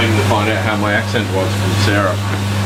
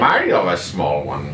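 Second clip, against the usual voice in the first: a lower, slower go at the same Warcraft Irish line; lower Warcraft imitation; 6 semitones lower, slower than usual. Partner repeats the game character's line in a mock Irish accent. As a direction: copy the line low and unsure, slowly, in a mock Irish accent. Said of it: "There's the Irish characters in Warcraft".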